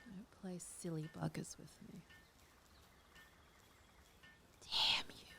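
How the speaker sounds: hushed scolding whisper; muttering at the stubborn game. Real, not acted. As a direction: scold the game under your breath. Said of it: "I don't know why I'm whispering".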